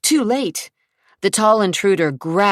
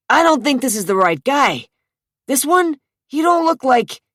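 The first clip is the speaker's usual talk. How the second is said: worried thug; 9 semitones higher, 6 dB louder than usual. Acted. Thug realizes mistake.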